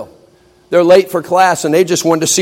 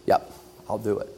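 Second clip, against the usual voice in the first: much softer, spent voice; barely has the breath left to agree; tired student on the floor; worn-out agreement; 6 semitones lower, 11 dB quieter than usual. Acted. Exhausted Pete agrees.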